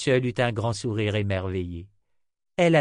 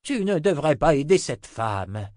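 put-on grumpy old wizard growl, raised in pitch; growled grumble; 5 semitones higher, 5 dB louder than usual. A narrator's reading. Old wizard warns the girl about that woman, grumbling. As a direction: grumble it like a cross old man. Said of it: "grogna Zed".

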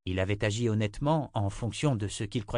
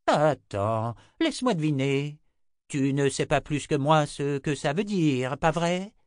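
sarcastic; 6 semitones higher, 4 dB louder than usual. Acted. Zed mocking grandson.

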